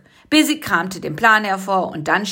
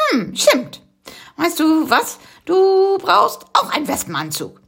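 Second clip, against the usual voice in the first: lightly voiced little bug; 10 semitones higher than usual. Busy has an idea.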